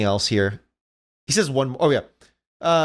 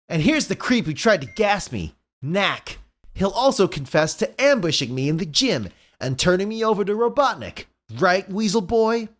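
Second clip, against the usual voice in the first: higher-pitched cartoon hero; smug hero voice, pitched up; cocky accusing Sonic; 6 semitones higher than usual. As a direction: show off the captured culprit, taunting him at the end.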